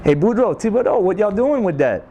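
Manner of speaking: high, calling Cajun voice; Cajun accent. Pretend. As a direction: call out to them Cajun style. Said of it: "they say".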